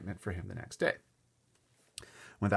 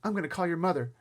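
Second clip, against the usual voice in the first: put-on higher, urgent boyish call; mild holler; 9 semitones higher, 4 dB louder, faster than usual. A narrator's reading. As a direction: call out that help is coming. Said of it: "Jimmy hollered".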